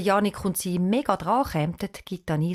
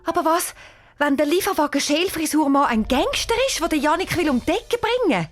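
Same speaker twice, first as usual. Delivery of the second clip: high, louder frightened question; panicked; 9 semitones higher, 3 dB louder than usual. Acted. Anisa fears the van man is a gangster, panicked. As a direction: voice the worst fear out loud. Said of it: "sagt panisch".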